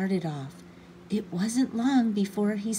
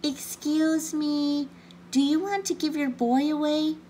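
polite calling out; 8 semitones higher than usual. A narrator's reading. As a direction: call out politely, stretching the words high. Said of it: "and called out politely".

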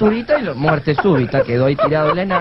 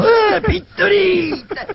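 high, drawn-out mock wailing; hammed-up crying; 11 semitones higher, much slower than usual. Dwarfs mourning Pinturita at the circus, hammed-up crying. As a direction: wail each word out in a high, squealing sob. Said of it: "Enanos llorando".

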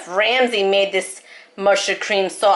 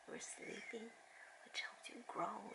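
whisper; 32 dB quieter than usual. Real, not acted. Mother whispering bedtime advice to her little girl, soft whisper. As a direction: hush it, barely breathed.

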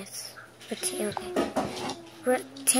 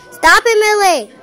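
angry shout; 6 semitones higher, 7 dB louder than usual. Real, not acted. Yelling at sibling.

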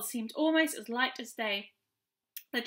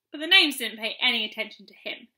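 plain reading voice

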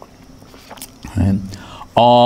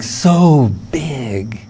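drawn out; 3 semitones higher than usual. Acted. Infinite imagined as huge.

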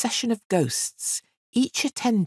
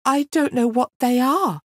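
much higher, small strained voice; helpless girl; 9 semitones higher, 4 dB louder than usual. Acted. Girl admitting ignorance to the fiend, high and small. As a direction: admit it weakly. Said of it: "she said".

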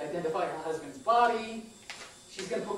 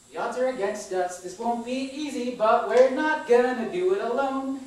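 louder, higher and slower, building to a defiant call; rising rallying cry; 7 semitones higher, 10 dB louder, slower than usual. Acted. Speaking to queer and artist family, a swelling defiant rallying cry. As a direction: lift them up, swelling to the last word.

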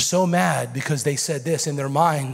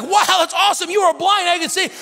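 amazed delight; 14 semitones higher, 5 dB louder than usual. Retold or imitated. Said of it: "a religious leader would be like".

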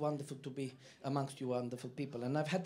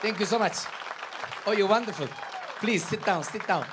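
loud, raised-pitch gratitude; calling over applause; 5 semitones higher, 10 dB louder than usual. Real, not acted. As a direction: thank the applauding crowd at full volume. Thanking the crowd after the song.

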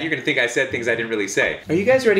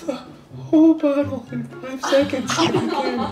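laughing; 12 semitones higher than usual. Real, not acted. Laughing while explaining.

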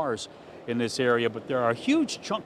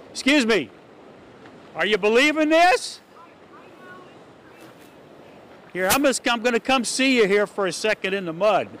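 calling across the street, raised and higher; 9 semitones higher, 5 dB louder than usual. Real, not acted. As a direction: call out to get her attention from afar. Reporter calls to neighbor across street.

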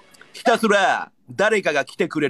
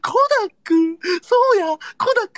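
excited shouting; 13 semitones higher than usual. Real, not acted. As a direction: cheer the name out wildly. Cheering a viewer's gift.

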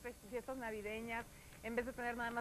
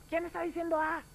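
high, loud question thrown back; raised-voice shouted question, echoing; echoed shout; 6 semitones higher, 6 dB louder than usual. A narrator's reading. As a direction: answer back as the echo. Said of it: "escucha que le responden".

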